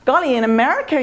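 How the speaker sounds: high, gushing American "gee golly" voice; American accent. Retold or imitated. American tourist startled by the waitress's answer, high American accent.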